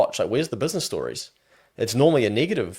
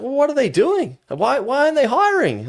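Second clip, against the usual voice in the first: baffled American; 4 dB louder than usual. Retold or imitated. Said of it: "He's like".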